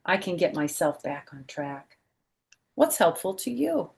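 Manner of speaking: brighter, lively read-aloud voice; storybook reading voice; reading to children. Acted. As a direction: read it out to a class of kids. Reads picture book aloud.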